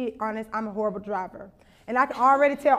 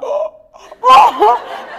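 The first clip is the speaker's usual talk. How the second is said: gasping oh, ending high and loud; seeing the girl flip her off, gasping in shock; shocked gasps; 8 semitones higher, 11 dB louder, much slower than usual. Retold or imitated. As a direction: gasp in disbelief.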